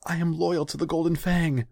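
very high, loud cry of loyalty; pleading, strained high shout; desperate plea. A narrator's reading. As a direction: cry out your innocence at high pitch. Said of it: "still panting".

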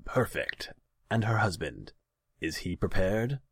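pleased sly ruler; scheming Khan. A narrator's reading. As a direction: savor the plan. Khan questions servant.

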